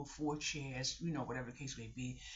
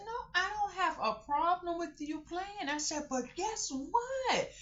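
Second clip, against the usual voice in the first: put-on high slow sing-song to a kid; high drawn guess what; child-directed talk; 4 dB louder than usual. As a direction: tease the child gently. Talking sweetly to kids.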